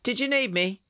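put-on an eager young guard; a hint of a dutiful young soldier.

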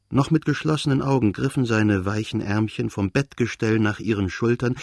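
plain reading voice

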